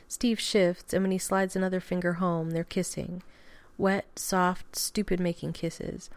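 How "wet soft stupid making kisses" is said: ordinary delivery, nothing marked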